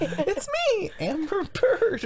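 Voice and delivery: very high squeaky bird voice; furry character. Pretend. Announcing a new furry persona, silly high character voice. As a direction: squeal the name, stretching it out. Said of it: "I'm gonna be a furry again".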